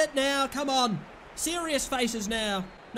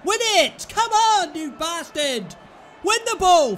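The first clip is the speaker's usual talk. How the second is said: much higher and louder, yelling at the team; angry shouting; 6 semitones higher, 7 dB louder than usual. Real, not acted. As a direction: bellow at them to win it. Screaming at players to win ball.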